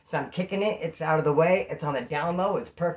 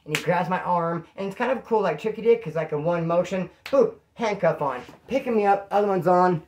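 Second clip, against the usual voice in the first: ordinary talk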